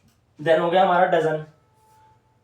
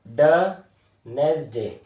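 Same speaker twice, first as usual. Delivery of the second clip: 1 slow drawn-out word; 3 semitones lower, much slower than usual. Shown as a demonstration. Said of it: "teacher drawing and saying word".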